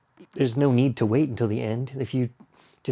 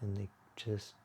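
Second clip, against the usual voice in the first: unhurried, hushed yoga-class body scan; guided meditation; 7 dB quieter than usual. Acted. Leads a guided relaxation.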